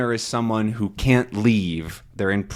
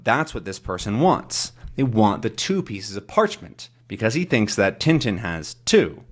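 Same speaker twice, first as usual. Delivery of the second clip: ordinary delivery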